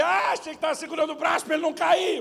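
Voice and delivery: high, loud sarcastic yell; dad's bursting sarcasm shouted; imagined sarcastic shout. Retold or imitated. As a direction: bellow the sarcasm. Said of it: "Eu tenho vontade de dizer".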